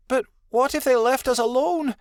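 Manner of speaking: high, fearful whimper. A narrator's reading. Adelaide fears being left behind, whimpering high. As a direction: worry aloud in a very high voice. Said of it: "Adelaide whimpered".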